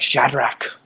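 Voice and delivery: big Irish bruiser screaming a name; Irish shout. Pretend. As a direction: bellow the name like an angry Irishman. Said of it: "screaming at you in an Irish accent".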